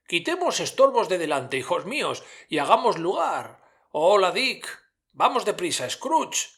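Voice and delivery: mucho más agudo, festivo; Fezziwig jovial. A narrator's reading. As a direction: anuncia la fiesta con alegría. Fezziwig ordena despejar el salón. (Fezziwig a sus aprendices, agudo y festivo)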